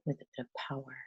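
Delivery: unhurried breath-led guidance, softening as it settles; guided meditation. Acted. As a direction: gently guide them through breathing, leaving long pauses.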